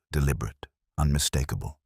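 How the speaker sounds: ordinary delivery, nothing marked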